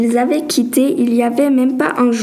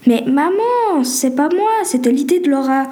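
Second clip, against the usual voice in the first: whiny protest; 3 semitones higher than usual. Acted. Daughter protests blame to mother.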